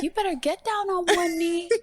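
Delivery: put-on high, loud little-girl voice; two-year-old daughter. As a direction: imitate a bossy toddler giving orders. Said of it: "My daughter at the time, she's two".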